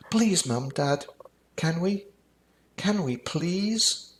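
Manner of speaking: put-on high, slow pleading child; begging child. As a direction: draw the please out like a begging kid. Child begs parents.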